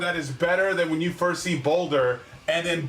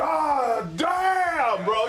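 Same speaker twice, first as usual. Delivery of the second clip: mock amazed shout; 6 semitones higher, much slower than usual. Voicing player's reaction.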